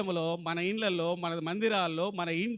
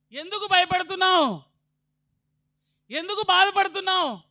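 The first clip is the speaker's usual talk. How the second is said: high, loud preacher calling out to the church; full-force sermon questions; fiery preaching; 10 semitones higher, 8 dB louder than usual. Acted.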